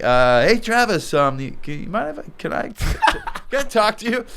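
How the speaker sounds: high, halting, nervous ask breaking into a laugh. Retold or imitated. Asking Travis to talk privately, nervous and laughing. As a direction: stammer out the request, too nervous not to laugh. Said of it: "and I'm like".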